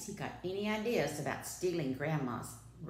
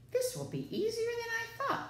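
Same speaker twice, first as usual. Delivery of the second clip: quick, high-pitched snarled remark; wolf's snarl; faster than usual. A narrator's reading. Wolf finds Grandma gone, snarled gloating. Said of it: "the wolf snarled".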